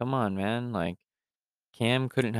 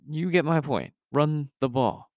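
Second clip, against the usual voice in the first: higher and louder with each run the ball; building fired-up rant; 7 semitones higher, 3 dB louder than usual. Real, not acted. Co-host yelling at shitty teams out there to run the ball, building shout. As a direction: yell it at the bad teams, building each time.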